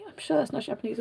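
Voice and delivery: very soft, hushed murmur; mock cinema whisper. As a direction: murmur it secretly to the person beside you. Repeated whisper to boyfriend during film.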